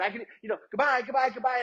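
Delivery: high, manic genie voice waving goodbye; Robin Williams Aladdin genie. Retold or imitated. Pitching himself to the stressed gate agent as a zany genie, high and manic. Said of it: "like, Robin Williams Aladdin thing".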